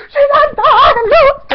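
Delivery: slow, high screamed celebration; very loud squealed joy; going crazy. Retold or imitated. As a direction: scream it to the whole street. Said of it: "Like, I'm going crazy".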